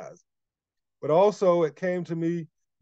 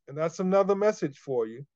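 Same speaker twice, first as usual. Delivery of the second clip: ordinary talk, nothing marked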